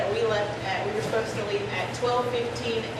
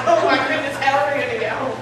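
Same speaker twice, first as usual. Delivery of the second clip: loud, higher mock wail with a laugh in it; retold tearful panic; 4 semitones higher, 7 dB louder than usual. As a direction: re-enact crying out in distress, half laughing. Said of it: "I was crying".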